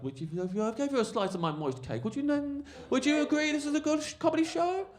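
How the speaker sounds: squeakily high put-on comic voice; mock-innocent pleading. Comic bargaining with heckler over mime cake, rising high plea. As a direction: climb into falsetto as you imitate a pestering comic.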